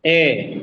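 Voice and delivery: measured teacher's ए; isolated syllable. Shown as a demonstration. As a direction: give ए as a separate syllable. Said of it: "ये तीन सब syllable से बना".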